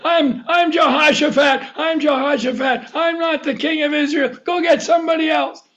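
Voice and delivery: high, urgent plea, acted out; crying out. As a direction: call out in protest, pitched well up. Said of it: "I think he cried out to the chariot".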